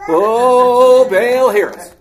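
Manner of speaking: loud, high tent-revival wail; revival cry. Acted. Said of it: "like a tent revival".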